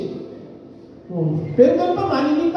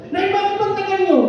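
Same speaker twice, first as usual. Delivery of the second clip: put-on child calling to mother; 10 semitones higher than usual. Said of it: "Tapos kato ka ng kato".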